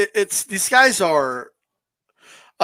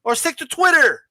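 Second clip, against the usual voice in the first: mocking rant; 8 semitones higher than usual. Host mocks a Wilder fan who insulted him, high and jeering. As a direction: taunt the fan like a schoolyard bully.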